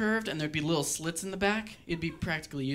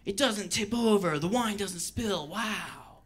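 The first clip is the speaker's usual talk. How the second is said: amazed ad people; 2 semitones higher, much slower than usual. Retold or imitated. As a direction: ham up the astonishment of a TV ad. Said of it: "and they're like".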